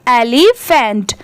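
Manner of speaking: एलिफेंट slowly, as एलि plus फेंट; parts joined slowly. Shown as a demonstration. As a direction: break एलिफेंट into two drawn-out parts. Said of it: "पूरा मिला देंगे".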